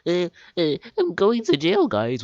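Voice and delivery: high-pitched, whiny mock of a video creator; exaggerated high mocking voice; mocking clickbait mimicry. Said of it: "clickbaited the title".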